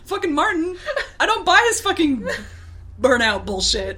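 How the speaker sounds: high, laughing outrage; mock-indignant rant. Real, not acted. Host ranting to co-host about Martin, comically indignant.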